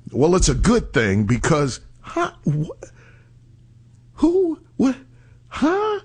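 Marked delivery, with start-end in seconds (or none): 2.0-6.0 high confused yelps; mock confused. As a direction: play dumb with squeaky huhs. Feigned confusion, high squeaks.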